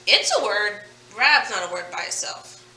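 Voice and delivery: ordinary talk, nothing marked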